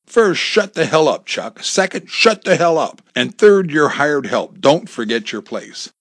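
loud, high-pitched telling-off, easing at the end; angry rebuke. Acted. Boss silencing his hired help, angry rebuke. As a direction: put the hired help firmly in his place.

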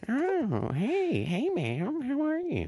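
high sleazy pickup line voice; flirty high voice. Pretend. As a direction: greet her like a sleazy flirt. Hitting on a woman.

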